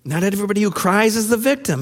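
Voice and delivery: usual speaking voice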